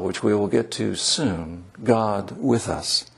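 usual speaking voice

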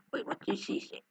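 hushed whispered question. Real, not acted. Player whispers an aside while missing the game's line. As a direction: whisper it to yourself. Quiet aside during game.

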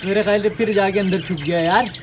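ordinary talk, nothing marked

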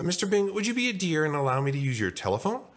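high, quick sugary request; syrupy high favour-asking; extra polite. Acted. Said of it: "Arnie asked extra politely".

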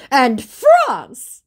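high, loud proclamation; mock indignant scammer. Scammer adding another country to blame, mock indignant. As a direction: fling it out as an accusation.

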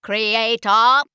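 loud, high metallic boom; thundering Dalek. Acted. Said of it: "the voice thundered".

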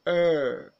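loud, sustained "Er" with a falling tune; long er vowel. Shown as a demonstration. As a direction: model "Er" for repeating, held long and forceful. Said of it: "Listen, repeat after me".

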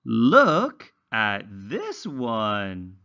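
slow, high, sing-song storybook reading; reading to children. Acted. Teacher reading a simple story to young English learners, slow and sing-song. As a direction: read it slowly like a picture book for little ones.

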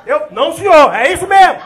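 loud shouted army reply; shouting recruit. Retold or imitated. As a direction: answer the officer at full volume. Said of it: "Tudo gritando".

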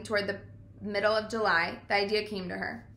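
ordinary delivery, nothing marked

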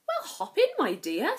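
high, cheery invitation; chirpy old fairy; fairy godmother. Acted. Fairy godmother invites Cinderella into the coach, cheerily. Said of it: "said the woman".

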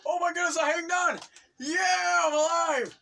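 high, loud cry of relief; elated shouting. Real, not acted. Player who hung on and survived, elated shouts. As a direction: shout in amazed triumph.

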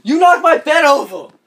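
high, loud accusing shout; real indignant outburst; angry yell. Real, not acted. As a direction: yell it in high-pitched outrage. Yelling at person who knocked bed.

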